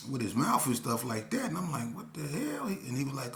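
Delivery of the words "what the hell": high, startled what the hell; shocked. Retold or imitated. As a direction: blurt it out in disbelief. Shocked reaction in retold street scene.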